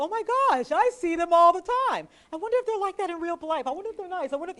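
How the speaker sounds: high, loud, gushing fan squeal; starstruck gushing. Retold or imitated. Viewer spotting a TV reporter in public, high starstruck squeal. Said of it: "and you go".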